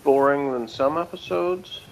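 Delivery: usual speaking voice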